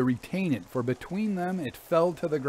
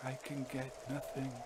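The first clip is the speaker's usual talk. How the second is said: very soft, faint confession; almost a whisper; 15 dB quieter than usual. A narrator's reading. Son confesses failure to father, almost whispering. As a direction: barely let the words out. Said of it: "came almost in a whisper".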